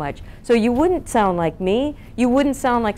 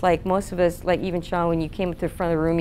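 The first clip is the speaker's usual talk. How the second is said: normal talking voice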